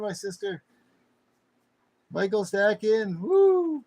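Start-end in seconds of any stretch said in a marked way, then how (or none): 2.1-3.8 high, loud whooping greeting; excited whoop. Real, not acted. Streamer welcoming Michael Stackin, loud excited whoop. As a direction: shout out his name with a cheer. Cheering a returning viewer.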